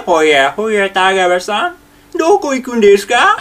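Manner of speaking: teacher combines voice and manner for the full Takiguchi; Takiguchi Junpei voice. Shown as a demonstration. 声も話し方も滝口順平そっくりに. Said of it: "これ合わせることによって初めて".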